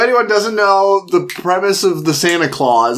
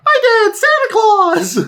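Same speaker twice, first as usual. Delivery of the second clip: mock kid voice. Son defending Santa, very high childlike squeal. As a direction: squeal it like an excited little kid. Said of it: "his son's like".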